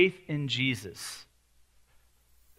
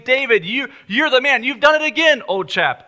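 cheery posh British praise at high pitch; hammed-up British well-wisher; British accent; 9 semitones higher, 7 dB louder than usual. Crowd cheering David in a mock British accent. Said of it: "I don't know why they were British".

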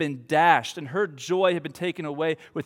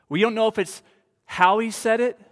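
ordinary talk

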